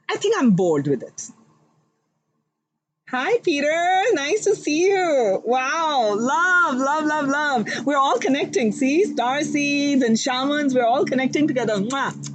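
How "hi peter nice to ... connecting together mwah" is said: higher, sing-song delighted hello; gushing greeting. Real, not acted. Tarot reader greeting Peter in chat, high and gushing. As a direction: beam at a friend arriving.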